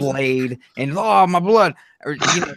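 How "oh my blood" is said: higher, louder pretend cry of distress; mock alarm. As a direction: play-act being cut open in the ring. Acting out a wrestling blade injury.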